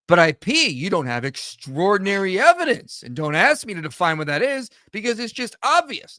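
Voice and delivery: high, exaggerated objector's whine; mocking imitation. Host parodying a challenger's argument about evidence, mocking. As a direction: mimic a critic with high, insistent mockery.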